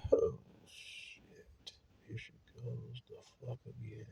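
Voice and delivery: whispered under the breath, fed up; weary whisper. Retold or imitated. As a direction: breathe it out like a tired husband. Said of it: "he'd have went".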